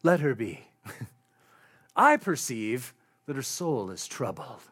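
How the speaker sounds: low, solemn mock-prophet voice; hammed-up holy man, pitched low; prophet gazing heavenward. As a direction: play a prophet pronouncing to the heavens. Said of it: "gazes up into the heavens and says".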